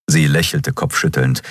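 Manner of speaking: plain reading voice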